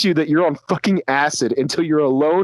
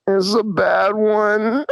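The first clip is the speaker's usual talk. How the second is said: mock-sad; 5 semitones higher, much slower than usual. Sad look, tripping, to friend.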